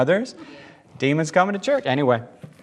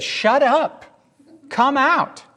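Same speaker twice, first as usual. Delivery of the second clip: mock rebuke; 11 semitones higher, much slower than usual. Mock casting out, high.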